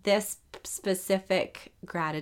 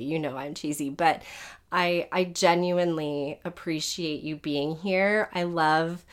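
ordinary delivery

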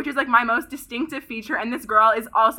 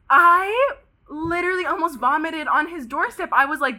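normal talking voice

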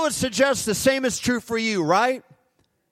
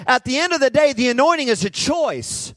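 louder, higher, preaching hard to the crowd; full-force preaching; 5 semitones higher, 5 dB louder than usual. Acted. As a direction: thunder it from the pulpit.

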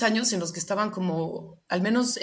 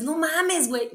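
much higher, playful Mexican exclamation; Mexican slang exclamation; 10 semitones higher, slower than usual. Retold or imitated. Saying Mexican slang the Spaniards don't get, much higher and playful. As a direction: toss it out like a Mexican to a friend. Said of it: "O le dices".

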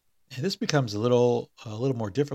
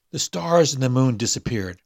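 ordinary delivery, nothing marked

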